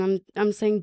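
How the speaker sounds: ordinary talk, nothing marked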